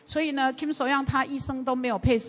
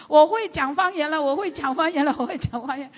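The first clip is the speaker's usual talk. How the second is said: higher, louder joyful repeat that fades at the end; excited; 5 semitones higher, 5 dB louder than usual. Retold or imitated. Student calls the pastor, thrilled to speak in tongues. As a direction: burst out with happy news, then settle. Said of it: "他好兴奋哦".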